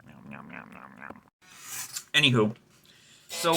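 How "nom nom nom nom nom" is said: much softer whispered chewing sounds; narrating the dog eating, in a whisper; whispered nom nom. Pretend. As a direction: whisper the dog's munching.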